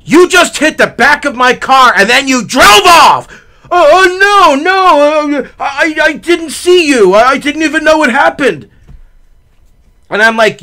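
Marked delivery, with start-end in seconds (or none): 0.0-3.3 loud, raised indignant retelling; angry accusation. Retold or imitated. Hit driver confronting the man who fled, angry. 3.7-8.7 high, stammering frightened protest; flustered denial. Retold or imitated. Older driver claiming he didn't see, flustered.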